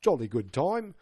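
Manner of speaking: hammed-up British bloke; cheery mock-British greeting, laid on thick; overdone British accent. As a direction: play up a jolly British accent. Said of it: "going for the overly blo".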